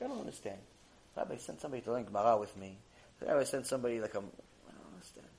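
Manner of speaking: hushed, puzzled murmur to himself; confused. Acted. Man puzzling over why the rabbi sent people, quietly confused. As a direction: act baffled, very softly. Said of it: "He's confused. He's like".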